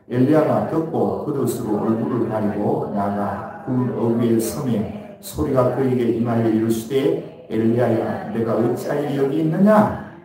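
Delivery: steady, measured Bible reading to the church; scripture reading. Acted. Pastor reading scripture to the congregation, measured. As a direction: read the verse aloud, even and formal. Reads verse after "시작.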